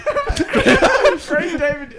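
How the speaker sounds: drawn-out name through laughter; slow, giggling name-drop; laughing. Real, not acted. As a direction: stretch the name out while cracking up.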